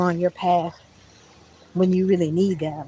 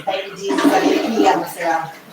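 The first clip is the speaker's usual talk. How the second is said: laughing relief. Real, not acted. Telling viewers she made it to the bathroom, laughing.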